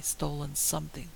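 ordinary delivery, nothing marked

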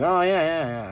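mock agreement. Dreamer humours the Russian guide, acted agreement. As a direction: play along with a retold nervous yes. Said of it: "I'm, like, agreeing".

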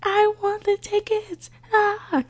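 high, excited yell for the tickets. Retold or imitated. Fan at a fair yells high for free tickets. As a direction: scream to win the seats. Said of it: "I just start yelling in my seat".

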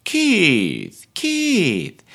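long falling call, pitched high; Alison Steadman. Retold or imitated. Coach repeats the character's call, high mimicked wail. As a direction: imitate her insistent, drawn-out Keith. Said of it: "the way she says".

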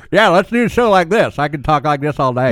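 a mock Southern accent, gums only; toothless Southern accent. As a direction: put on a hammy Southern accent, teeth out. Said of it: "I have to do the Southern accent on that".

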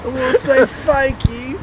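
high, slow mock British voice; British accent. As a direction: thank them in a hammy British accent. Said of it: "Just say that in your British accent".

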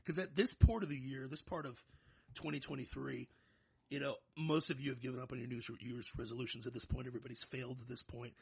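normal talking voice

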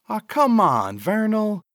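high, whining pleading man; whiny high plea. Acted. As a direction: plead it like a scared sidekick. Cecil pleads with Vernal.

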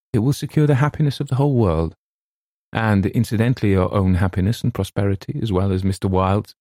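usual speaking voice

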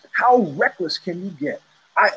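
normal talking voice

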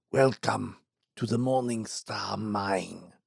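a lightly voiced frail, bitter old man; ailing employer muttering darkly of revenge; sickly old mine owner. Dying owner's dismissal.

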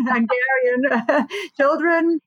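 laughing through the words; a slow, amused laugh around it; chuckling about her mixed-up heritage. Real, not acted.

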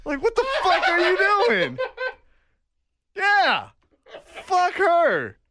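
high shouted get-off; high angry mock yell; retold yell. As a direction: yell it while being choked. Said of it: "and I'm like".

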